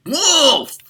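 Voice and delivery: high, loud alarm call; crying out. Acted. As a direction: yell it like a boy raising the alarm. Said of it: "And so he cried".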